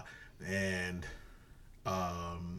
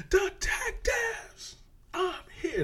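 way up high and drawn out slowly; a high, slow mock villain call; sudden high-pitched Kevin Spacey; 3 dB louder, much slower than usual. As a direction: jump up high and stretch each word. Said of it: "all of a sudden he's like".

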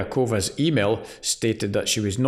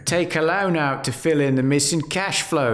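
put-on London accent; 5 semitones higher than usual. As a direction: ham up a London boss. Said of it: "what did she say in your London accent".

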